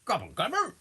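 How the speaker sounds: high dying goblin gobble; goblin chieftain. Acted. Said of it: "and he goes".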